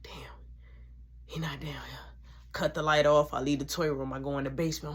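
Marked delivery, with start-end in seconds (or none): 0.0-2.0 hushed whisper to self. Retold or imitated. Kid whispering to himself in the dark toy room. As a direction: whisper under the breath.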